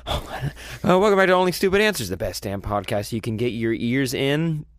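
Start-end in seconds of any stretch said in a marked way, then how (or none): 0.1-2.1 high, quick second go at the intro; fast, high-pitched show greeting; quick restart. Real, not acted. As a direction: rush the welcome out brightly pitched. Restarts intro after running out.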